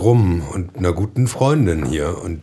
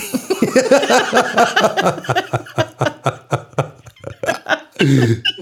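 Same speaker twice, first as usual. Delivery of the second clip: loud high cackling laugh; long high laugh; 3 dB louder than usual. Real, not acted. Burst of laughter at name.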